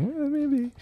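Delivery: a sly little shrug of a thought; mock scheming spouse. Host voicing a husband plotting at butchery class, high and sly.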